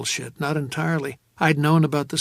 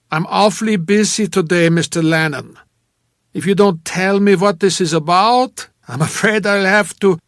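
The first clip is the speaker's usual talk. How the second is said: loud, raised, theatrical brush-off; booming actor; 5 semitones higher, 9 dB louder than usual. A narrator's reading. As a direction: project like an actor excusing himself. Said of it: "That jovial, booming actor's voice".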